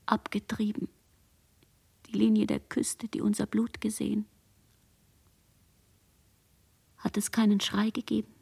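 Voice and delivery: breathless whisper, barely there, voice drained; hushed whisper. Acted. Girl whispers her memory of the night to herself, hushed whisper. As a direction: whisper it as if the dead could hear. Survivor girl recalls the massacre.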